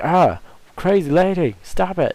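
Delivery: high, loud, exasperated shout; hammed-up frantic plea; mock yelling at the boss. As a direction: yell at the flying head to quit. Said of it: "and I'm like".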